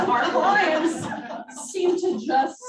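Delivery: cracking up mid-sentence; laughing while talking. Real, not acted. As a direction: try to finish the sentence through laughs. Cracking up mid-sentence over made-up stats.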